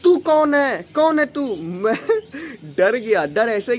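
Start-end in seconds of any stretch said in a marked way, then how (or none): 0.0-1.6 shouted, higher: who are you; loud, high imitation of the furious aunty; mock yelling aunty; mock angry aunty. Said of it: "कहने लगती हैं". 1.6-2.7 laugh. Real, not acted. Laughs recalling his fear.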